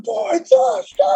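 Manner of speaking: high mock crowd protest; a crowd begging you not to. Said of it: "everybody's like".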